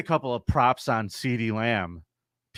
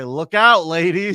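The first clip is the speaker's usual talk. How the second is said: loud, higher mock warning; loud warning call; 6 semitones higher, 9 dB louder, slower than usual. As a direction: call it out like a public alarm.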